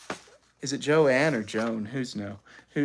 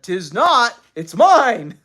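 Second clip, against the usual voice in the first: loud, raised-pitch contradiction; quarreling retort; 7 semitones higher, 9 dB louder, slower than usual. A narrator's reading. Father denying the towel is mother's, arguing loudly. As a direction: snap back loudly.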